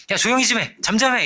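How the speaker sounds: 높은 목소리로 살짝 성난 군중 흉내; 조용히 시키듯 높여 나무라는 연기; 군중의 꾸짖음. Acted. 군중이 맹인을 꾸짖는 장면. (군중을 흉내 내며 높은 톤으로 꾸짖듯)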